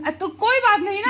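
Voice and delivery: high, loud insistent pleading; pleading refusal. Real, not acted. Daughter refuses money from father, high loud pleading. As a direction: beg him to keep his money.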